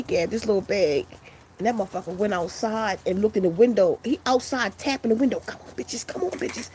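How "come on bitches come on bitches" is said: urgent whisper. Retold or imitated. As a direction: whisper them out from the window. Friend tapping restaurant window outside.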